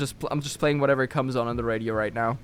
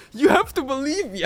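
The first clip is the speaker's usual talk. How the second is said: mock pleading; 11 semitones higher than usual. Pleading he was in Japan.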